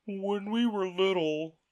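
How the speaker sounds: slow, high, mournful turtle voice; a weepy old turtle settling in to tell his tale; mock turtle voice. The Mock Turtle to Alice, slow and high.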